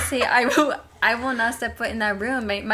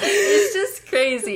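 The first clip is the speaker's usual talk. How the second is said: mock crying; 10 semitones higher, 6 dB louder, slower than usual. Mock sob over fake sad news.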